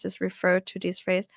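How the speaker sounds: ordinary talk, nothing marked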